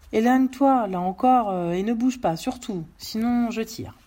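ordres forts, attaque plus aiguë; ordre menaçant. Acted. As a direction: tiens l'otage à distance par la voix. Menace son otage d'une arme. (menace autoritaire de l'homme armé)

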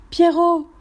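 high, loud call down the well. Acted. Maid calling the dog down the well, loud high shout. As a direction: shout the dog's name into the pit. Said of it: "Rose cria".

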